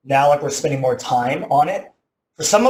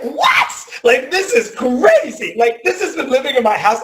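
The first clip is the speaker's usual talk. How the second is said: shocked outburst; 9 semitones higher than usual. Retold or imitated. Finding roach eggs in his AC vent, shocked shriek. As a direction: shriek it out, stunned and half laughing.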